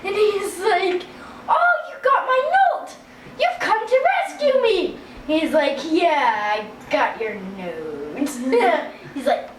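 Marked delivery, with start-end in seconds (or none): 1.5-5.0 high, gushing prince voice; hammed-up, high-pitched delight; delighted captive prince. 5.9-8.2 low, unimpressed mock-hero voice; low comic contrast to an excited prince; deadpan knight reply.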